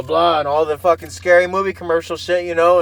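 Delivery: normal talking voice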